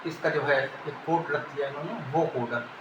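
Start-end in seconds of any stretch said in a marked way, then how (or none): none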